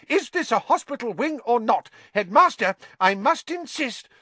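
high-pitched, outraged protest; hysterical. A narrator's reading. Nurse complaining to headmaster about visitors, hysterical. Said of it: "said Madam Pumphrey hysterically".

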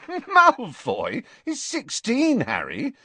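high, loud, incredulous laughing speech; erupting in laughter. A narrator's reading. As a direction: laugh through it in disbelief, stretching the words. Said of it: "Ron erupted in laughter".

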